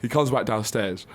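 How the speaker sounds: ordinary talk, nothing marked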